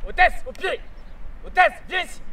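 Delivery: sharp loud high call to the dog; shouting at the dog. Shown as a demonstration. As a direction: yell the recall command. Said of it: "on a tendance à lui crier dessus". (appel crié au chien)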